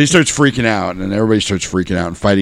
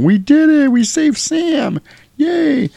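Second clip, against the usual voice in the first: high-pitched cartoon cheering; mock celebration; much slower than usual. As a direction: squeal a mock hooray, drawn out at the end. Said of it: "and everybody's clapping".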